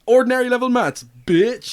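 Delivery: loud boast. Real, not acted. Boasting after the rhyme.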